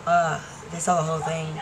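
ordinary talk

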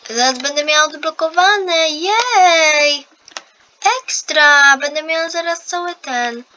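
excited. Real, not acted. About to unlock an item.

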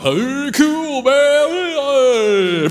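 put-on loud, high, drawn-out drunken holler. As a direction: slur it out loud like a carefree drunk. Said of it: "This is the drunk".

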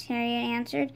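plain reading voice

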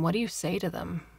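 ordinary delivery, nothing marked

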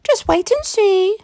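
put-on high, bright old lady voice; wife proposing a plan to her husband; Missus Grinling. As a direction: play a brisk little old wife.

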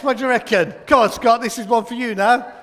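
normal talking voice

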